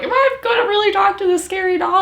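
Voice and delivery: high, loud, rushed mock squeal; mock panic. Recalling the dread of talking to a scary doll, mock panic. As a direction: blurt it high and fast in an exaggerated voice. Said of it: "'Cause I was like".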